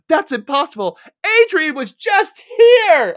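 high, loud, stretched-out mock yell. Kim answering Lisa on the phone, yelling it. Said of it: "Like, she yells it".

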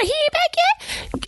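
high, slow disbelief; surprised high question. Retold or imitated. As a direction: ask it in high-pitched surprise. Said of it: "She said".

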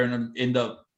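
normal talking voice

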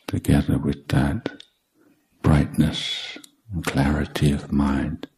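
ordinary delivery

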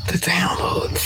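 slow whispered The downloads. Shown as a demonstration. Savoring the podcast downloads in a slow whisper. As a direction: breathe it out as a whisper.

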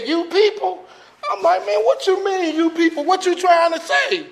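high mock-offended disbelief, laughing; indignant. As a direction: act put out and incredulous, half laughing.

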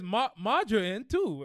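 loud, bad British "margarine"; mock-British margarine, drawn out; terrible UK accent. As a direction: put on a poor UK accent.

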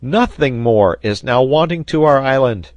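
high, loud declaration; overjoyed sailor. A narrator's reading. Sailor delighted with his new tobacco, overjoyed.

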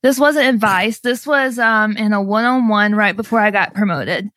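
ordinary talk, nothing marked

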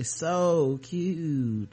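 a slight creak dragged down on "So cute"; vocal fry demo. Shown as a demonstration. Host demoing vocal fry for her co-host, lightly creaky. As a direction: drag "So cute" down and let it rattle a little. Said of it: "Vocal fry is that thing where you drag your voice down a little and creak it".

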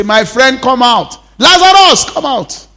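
calling Lazarus out loud and high. Acted. Pastor voicing Jesus calling Lazarus from the tomb, loud call.

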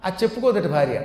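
usual speaking voice

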